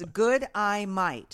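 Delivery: slow Aussie good eye might, spelled out sound; Australian accent. Shown as a demonstration. Host repeating the Aussie greeting for co-host. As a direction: demo the Aussie greeting carefully. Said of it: "let me say it again".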